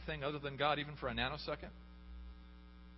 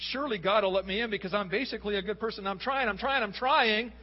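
raised, louder, insistent pleading; desperate self-justifying plea; 6 semitones higher, 7 dB louder than usual. Acted. Preacher voicing someone earning their way to heaven, desperate plea. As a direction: plead your case, pressing harder with each repeat.

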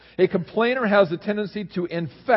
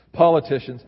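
politicians, the word hit louder; stress on politicians; 5 dB louder than usual. Real, not acted. Reading a quote to the congregation, louder on politicians. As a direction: punch politicians. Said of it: "I don't know why I emphasize that word".

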